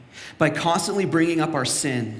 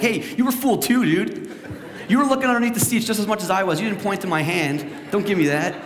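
laughing retort; 7 semitones higher, 3 dB louder than usual. Retold or imitated. Joking back at friend.

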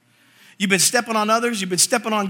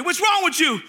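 high-pitched mock scolding; dad yelling; 8 semitones higher than usual. Dad yells at son to lift the block, mock scolding. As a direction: imitate a dad yelling impatiently. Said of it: "my dad's yelling at me".

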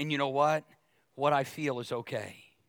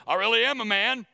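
mock macho man; 8 semitones higher, 7 dB louder than usual. Mock manly protest after crying.

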